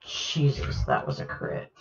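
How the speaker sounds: hushed, breathless whisper; awed whisper. Real, not acted. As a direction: whisper it in awe. GM awed by brutal crit.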